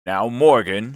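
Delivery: stern landlord voice. A narrator's reading. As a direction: call him to account. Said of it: "said Long John very sternly".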